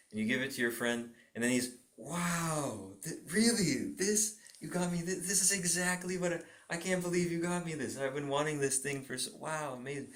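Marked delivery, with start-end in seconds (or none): 2.0-10.1 excited. Retold or imitated. Friend receives a small gift.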